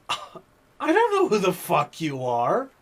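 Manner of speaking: hammed-up indignant brush-off; mock angry retort. Driver retelling what he told the pushy customer, slow mock anger.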